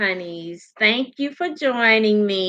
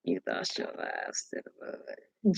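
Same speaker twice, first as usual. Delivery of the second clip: whisper; 14 dB quieter than usual. Real, not acted. Reading a comment aloud, whispered.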